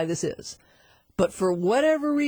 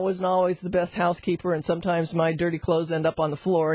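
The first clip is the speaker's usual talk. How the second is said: ordinary delivery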